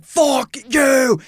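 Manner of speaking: high, drawn-out mock yell; acted-out furious insult; imitating angry cyclist. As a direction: hurl it as an enraged stranger. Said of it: "and he's like".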